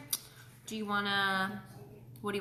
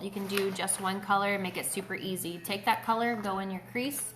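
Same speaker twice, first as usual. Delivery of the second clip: ordinary delivery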